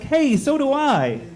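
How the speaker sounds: coworker Devin finding a fellow Prius driver, high and gleeful; coworker's high voice. Retold or imitated. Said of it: "He's like".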